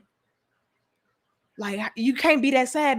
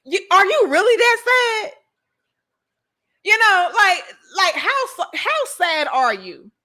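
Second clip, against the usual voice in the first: higher, louder incredulous question; mocking disbelief; 7 semitones higher, 4 dB louder than usual. Real, not acted. To viewers, ridiculing people who photograph their own tears, incredulous. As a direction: needle them with disbelief.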